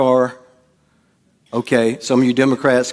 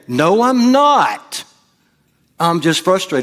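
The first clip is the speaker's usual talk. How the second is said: high, drawn-out protest, acted out; mock outburst, pitch soaring on a long NOT; angry denial; 5 semitones higher, slower than usual. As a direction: blurt the denial as if losing your temper. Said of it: "then I realized I was angry".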